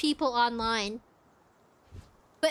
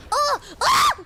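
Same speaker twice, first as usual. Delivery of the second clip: loud, high yelps; high, urgent exclamation at the game; alarmed shout; 10 semitones higher, 7 dB louder than usual. Real, not acted. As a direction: yelp out loud in sudden alarm. Startled during the game.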